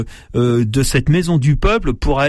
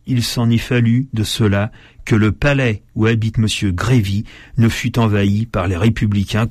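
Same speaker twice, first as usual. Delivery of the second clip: ordinary delivery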